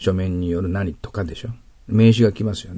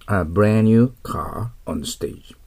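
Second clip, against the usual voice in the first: very slow, careful English reading; much slower than usual. Shown as a demonstration. Teacher reads the example slowly for students. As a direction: drag every word of the sentence out. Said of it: "reads the example sentence".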